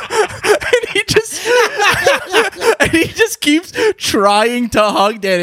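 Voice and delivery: high, squeaky laughing fit; helpless laughter. Real, not acted. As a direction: try to talk through uncontrollable laughter. Cracks up telling the story.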